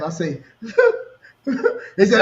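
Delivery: real laugh through the words; laughing while telling. Real, not acted. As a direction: laugh as you say it. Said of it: "this the part made me laugh".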